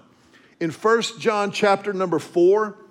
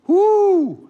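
a high, loud whoop of recognition; excited whoop; 13 semitones higher, 7 dB louder than usual. Real, not acted. Pastor whoops at the relatable pain, high and loud. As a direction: let out a big knowing whoo. Reacting to unrequited love story.

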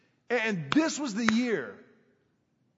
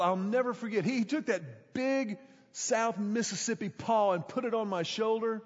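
ordinary delivery

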